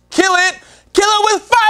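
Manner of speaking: high, loud shouted title; segment title shout. Acted. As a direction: roar the title like a game show.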